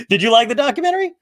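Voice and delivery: asking through laughter; laughing. Real, not acted. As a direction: laugh then ask quickly.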